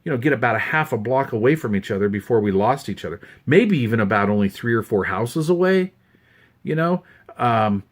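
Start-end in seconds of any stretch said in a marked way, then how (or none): none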